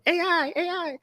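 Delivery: very high, put-on buzzing squeak; voicing a gnat. Gnat buzzing round the live stream, very high squeak. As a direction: squeak it like a tiny bug.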